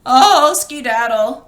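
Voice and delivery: a loud yelp of pain, then the word; wolf's loud cry. Acted. Wolf flat on his back, crying out loud. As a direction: cry out as you hit the floor.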